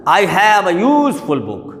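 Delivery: slow example sentence, USE in useful stretched; stress on USE. Shown as a demonstration. Teacher using the word in a sentence, useful stressed up front. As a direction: draw out the first syllable of useful. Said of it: "इस पर stress आया".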